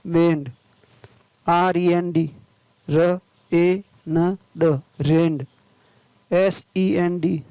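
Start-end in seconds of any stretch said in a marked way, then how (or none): 2.9-4.8 slow, separate phonics sounds; word broken slowly into its sounds; sounding out. Shown as a demonstration. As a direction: say each sound on its own. Phonics teacher sounding out spelling.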